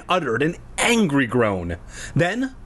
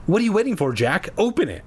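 higher, impatient demand; 6 semitones higher, faster than usual. A narrator's reading. Mia at the closed garage door, impatient. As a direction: hurry him along, mildly exasperated. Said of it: "she cried impatiently".

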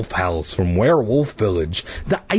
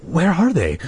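anxious cry; 7 semitones higher than usual. Acted. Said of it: "Clay cried".

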